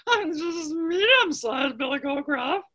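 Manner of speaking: slow, high, nervous goat voice; timid medium goat. Acted. Medium-sized goat answering the challenge, high and timid.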